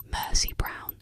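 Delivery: usual speaking voice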